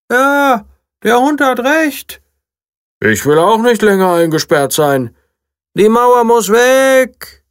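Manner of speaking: tiny bunny protesting; rebellious bunny. A narrator's reading. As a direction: demand the wall come down. Another bunny protests behind wall.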